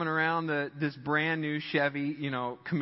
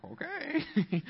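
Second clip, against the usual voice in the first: high put-on "okay"; mock hesitant novice; 11 semitones higher than usual. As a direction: play the unsure driver in a high voice. [name] acting the nervous novice.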